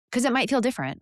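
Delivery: ordinary talk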